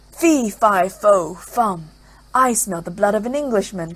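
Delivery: loud booming giant chant; ogre's cry. Acted. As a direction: sniff and boom like an ogre. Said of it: "cried out the ogre".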